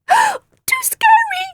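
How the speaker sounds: very high, slow frightened squeak. Acted. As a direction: squeak it in terror. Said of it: "squeaked Wriggle".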